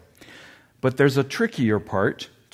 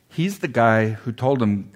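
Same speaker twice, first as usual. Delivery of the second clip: usual speaking voice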